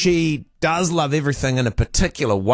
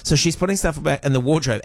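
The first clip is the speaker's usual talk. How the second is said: ordinary talk, nothing marked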